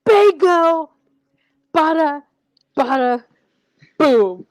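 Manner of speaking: loud, punchy burst, word by word; triumphant mock exclamation. Host acting out Dustin for co-host, triumphant exclamation. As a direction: call it out like a big reveal.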